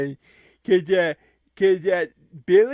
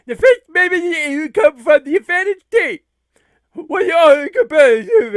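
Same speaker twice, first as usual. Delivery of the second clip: rapid, forceful rules read; ring announcer; 5 semitones higher, 7 dB louder, much faster than usual. Acted. Announcer setting out the war match rules, rapid-fire delivery.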